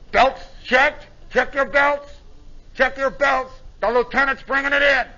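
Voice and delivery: crew chief on phones. Retold or imitated. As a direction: call the checks out to the crew. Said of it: "the crew chief, is on the phones".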